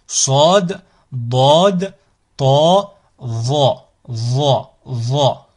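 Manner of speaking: Sod, Dod, Ta, Va with heavy, dark vowels; firm, backed emphatic consonants on Sod, Dod, Ta, Va; emphatic sounds. Shown as a demonstration. Teacher listing the four emphatic letters, heavy and dark. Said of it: "Ces quatre-là sont des sons emphatiques".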